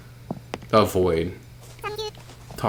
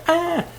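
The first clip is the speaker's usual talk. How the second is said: startled yelp; 4 dB louder than usual. Real, not acted. Catching the scorpion.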